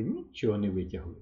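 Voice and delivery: ordinary delivery, nothing marked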